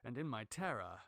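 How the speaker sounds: ordinary delivery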